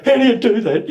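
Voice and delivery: high, laughing disbelief; mock amazement. Friend amazed at the accent trick, mock amazement. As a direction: marvel at it, half laughing. Bernie's amazed question, retold.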